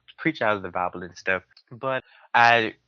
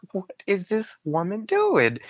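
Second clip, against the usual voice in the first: higher, bewildered inner thought; baffled disbelief; 9 semitones higher than usual. Retold or imitated. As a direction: react like you can't believe what's happening. His baffled thought mid-ritual.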